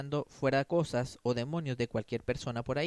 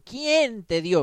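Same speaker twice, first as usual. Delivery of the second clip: loud, high indignant challenge; mocking the scribes' challenge; scribes challenging; 7 semitones higher, 8 dB louder, much slower than usual. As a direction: demand it like a haughty scribe. Preacher voices challenging scribes.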